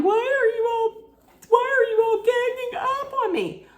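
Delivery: high, wailing fake sob, pitch jumped way up; mock crying whine. Mocking a castmate who fakes tears when called out, high crying whine. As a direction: whimper it like a hammed-up crybaby. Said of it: "So she starts crying".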